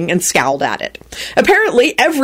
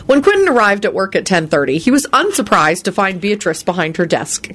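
plain reading voice

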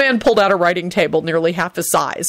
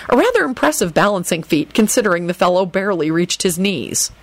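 usual speaking voice

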